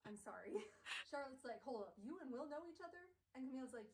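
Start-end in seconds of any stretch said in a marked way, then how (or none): 1.6-3.0 surprised. Acted. Charlotte surprised.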